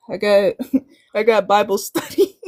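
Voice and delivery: real laugh breaking into the words. Real, not acted. Guest excusing herself for Bible study, laughing. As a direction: laugh lightly through the excuse.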